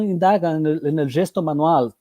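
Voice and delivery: Spanish-accented Portuguese, switching to Spanish; Chilean speaker blending Spanish into Portuguese; portunhol. Real, not acted. Slips into Spanish mid-sentence.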